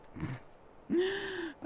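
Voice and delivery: a bright laugh at the mess; laughing at how silly it looks; amused laugh. Real, not acted. Laughs at odd result.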